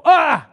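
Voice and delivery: sudden high, loud startled cry; scared scream. Shown as a demonstration. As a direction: jump back in fright with a yelp. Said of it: "I'm scared".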